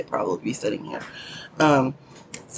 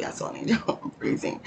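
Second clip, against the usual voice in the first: whispered; 8 dB quieter than usual. Real, not acted. Cold, laughing it off.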